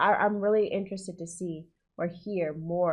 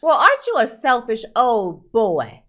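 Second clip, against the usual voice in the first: mock scolding; 3 semitones higher, 8 dB louder than usual. Real, not acted. Reactor scolding the character.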